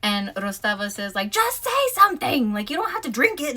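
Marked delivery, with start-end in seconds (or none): 1.3-3.6 much higher, pushy handler voice, a bit fast; mock high voice of an impatient handler; high urgent Rostova. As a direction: squeak out the urging like a nagging handler. Rostova urging in his earpiece.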